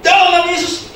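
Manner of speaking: very high, loud mock exorcist yell; screamed exorcism. Retelling tense exorcism of possessed person, yelling. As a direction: belt it out in comic panic. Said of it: "sampai teriak-teriak".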